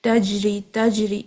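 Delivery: تجری with a bounced jeem; تجری with qalqala; correct jeem. Shown as a demonstration. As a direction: say it the right way. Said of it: "اس کو اسی طرح ہی پڑھا جاتا ہے".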